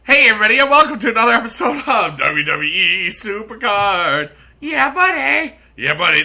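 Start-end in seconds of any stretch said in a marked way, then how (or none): 0.0-4.3 loud, high hype host shout; hype intro. Acted. 4.6-5.5 high, loud hyped shout; hype catchphrase. Acted.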